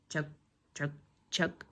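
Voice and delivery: low soft chug chug chug; low rumbling tractor chug; soft low engine chugging. Acted. Tractor passing.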